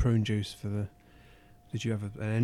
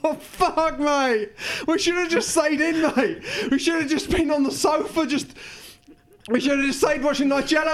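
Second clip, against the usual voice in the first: distressed; 8 semitones higher than usual. Acted. Injured after fall, distressed.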